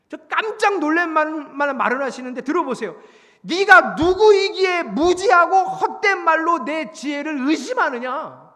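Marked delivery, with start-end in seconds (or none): 3.4-8.5 slow, loud, high-pitched booming demand; stretched-out, forceful accusation; thundering divine rebuke. Acted. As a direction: pronounce it like an outraged God from on high. God rebuking Job, acted.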